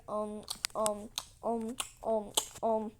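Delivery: string of punchy, hesitant ums; stalling stammer. Retold or imitated. Man with no answer for the angry queen, stalling.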